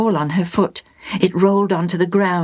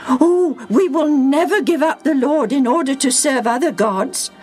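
raised, earnest crowd voice; the people's eager vow; 9 semitones higher than usual. Acted. The people answering Joshua, eager and earnest. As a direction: promise it with fervour.

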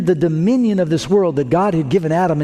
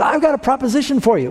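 much higher-pitched mock devil; 11 semitones higher than usual. Cartoon devil making Jesus an offer, in a high mock voice. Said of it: "and he said".

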